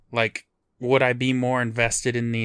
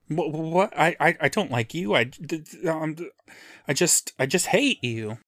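stammering, flustered protest, pitched up at the start; halting, stuttery awkward-guy impression; Jay Baruchel; 4 semitones higher than usual. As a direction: trip over every word while protesting. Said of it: "All right, Jay Baruchel".

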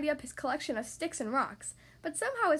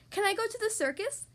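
put-on eager child asking; 9 semitones higher than usual. A narrator's reading. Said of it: "Little Rabbit asked his mother".

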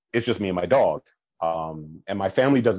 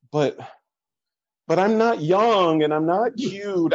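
whiny protest; 9 semitones higher than usual. Retold or imitated. Retold self-deprecating protest.

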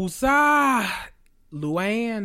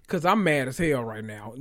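ordinary delivery

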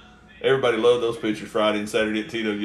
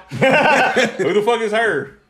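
laughing question; 10 semitones higher, 6 dB louder, much slower than usual. Retold or imitated. Laughing while retelling the question.

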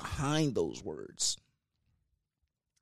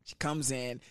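normal talking voice

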